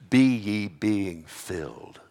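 "be ye being filled" slowed and weighted; stressed imperative. Shown as a demonstration. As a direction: lean slowly into "be". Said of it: "be is imperative in the Greek".

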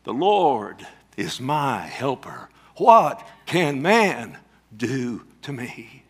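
very slow, weighty declaration; drawn-out, emphatic challenge from the pulpit; bold and confident. Acted. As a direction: stretch each word out with deliberate confidence. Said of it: "so that you may boldly and confidently say".

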